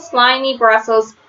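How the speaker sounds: ordinary delivery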